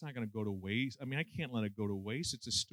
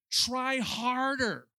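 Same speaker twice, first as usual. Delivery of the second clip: much higher, louder and very slow; preachy command; 10 semitones higher, 5 dB louder, much slower than usual. Retold or imitated. Preacher scolding struggling believers, loud slow command. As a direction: drag out harder like a stern preacher. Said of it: "Yaconelli says".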